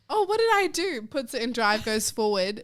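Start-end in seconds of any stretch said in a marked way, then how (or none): 0.1-1.0 imitating mum. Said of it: "she's like".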